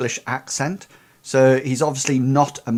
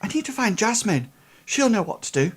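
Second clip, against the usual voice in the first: put-on high, anxious boy, faltering; fretful lad, pitched well up; nervous boy; 9 semitones higher than usual. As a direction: insist in a high, worried voice. Game master plays nervous boy.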